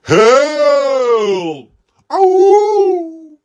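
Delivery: high crying yell of help and ow; hammed-up panicked scream; pretend shout for help. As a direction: howl for help as if caught by the primate. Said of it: "I will shout".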